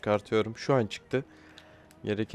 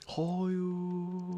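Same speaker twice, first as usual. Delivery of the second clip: dismayed no; 9 semitones higher than usual. Real, not acted. Substitution goes wrong.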